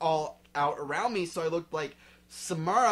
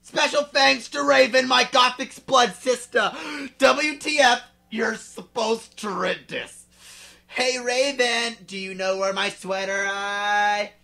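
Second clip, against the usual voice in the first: excited author's note; 7 semitones higher, 10 dB louder than usual. Acted. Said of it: "author's note read excitedly".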